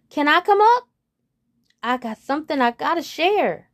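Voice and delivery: high, loud hopeful call; eager frog. Acted. Frog calling up to the treehouse, high and loud.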